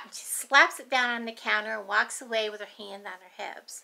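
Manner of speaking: ordinary talk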